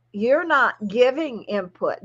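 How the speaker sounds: ordinary talk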